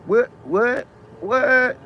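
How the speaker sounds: can't believe what he heard; escalating shocked what; stunned disbelief. Real, not acted. Stunned by the daughter comment.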